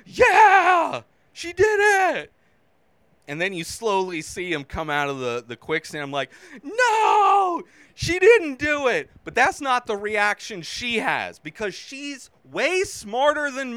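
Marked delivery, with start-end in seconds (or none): 0.0-2.3 high, loud triumphant cheer. Retold or imitated. Fan reliving her win, cheering high and loud. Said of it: "As I'm cheering". 6.6-9.1 high, loud groan of disbelief; dismayed cry. Retold or imitated. Viewer seeing the predator rise, loud dismayed cry. Reliving own disappointed reaction.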